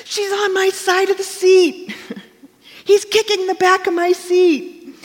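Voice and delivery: put-on high, loud kid complaining from the back seat; whining child. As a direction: tattle like a bickering kid on a road trip. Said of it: "blame game ... with your brother and sister".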